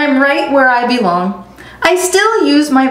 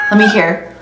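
ordinary delivery, nothing marked

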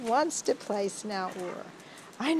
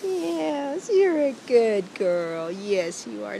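ordinary talk, nothing marked

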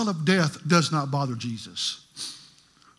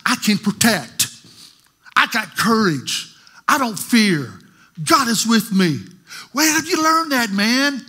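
higher and louder, driving each line; preaching at full force; 5 semitones higher, 5 dB louder than usual. Real, not acted. Preacher voicing the dying boy's courage to the church, impassioned.

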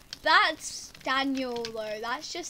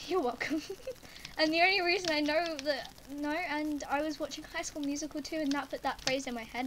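ordinary talk, nothing marked

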